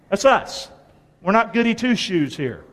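usual speaking voice

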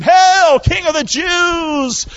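high, booming hail with drawn-out ending; shouted mock salute. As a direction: shout a mocking salute to a king. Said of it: "They began to salute him".

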